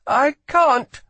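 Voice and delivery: high, loud, desperate insistence; young guard with foreign accent protesting; boy guard's refusal. A narrator's reading. Boy guard to Lila, desperate refusal.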